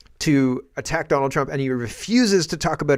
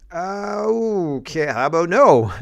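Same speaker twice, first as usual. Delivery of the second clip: drawn-out, higher-pitched okay into a pointed no; mock sarcastic refusal; 5 semitones higher, 3 dB louder, slower than usual. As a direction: stretch the hesitation, then refuse with sarcasm. Acted sarcastic reply to demand.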